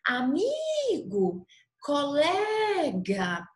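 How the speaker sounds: Amigo and Colega called slowly, stretching MI and LE; drawn-out hailing, stress held on MI in Amigo and LE in Colega; calling without shouting. Shown as a demonstration. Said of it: "como se a gente estivesse chamando".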